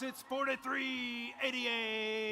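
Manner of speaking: slow, drawn-out hype call; fighting game announcer. Acted. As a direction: stretch every syllable for the arena. Team number drawn out for crowd.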